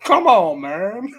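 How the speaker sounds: loud, high mock "come on, man"; hammed-up exasperated catchphrase; mock blurt. Catchphrase blurted out mid-laugh.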